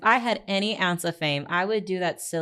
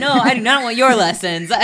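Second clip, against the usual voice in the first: loud, higher-pitched laughing protest; laughing refusal; 7 semitones higher, 14 dB louder than usual. Real, not acted. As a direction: refuse loudly through laughter. Laughing refusal of offered lessons.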